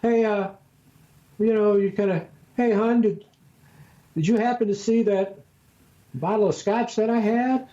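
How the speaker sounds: high falsetto, feigned innocence; high innocent voice. Retold or imitated. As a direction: ask sweetly up in falsetto. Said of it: "your voice goes high when you".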